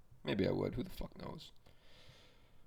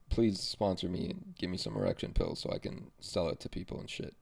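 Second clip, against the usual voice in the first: ordinary talk, nothing marked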